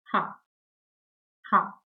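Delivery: ha said as "hah"; German letter H. Shown as a demonstration. Teacher showing learners the German alphabet, H as ha. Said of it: "is pronounced as".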